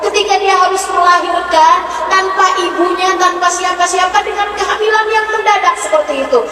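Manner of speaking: fervent preaching. Acted. Preacher to the congregation, preaching loudly and fervently. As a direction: preach with rising passion.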